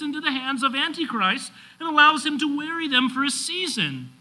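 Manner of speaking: high, ringing pulpit declamation; urgent, driving sermon crescendo; preaching at full force. Real, not acted.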